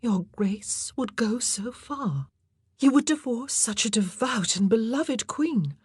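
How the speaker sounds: high, whispery woman's voice in disbelief; shocked young courtier. A narrator's reading.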